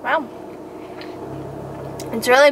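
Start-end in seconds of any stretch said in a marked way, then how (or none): none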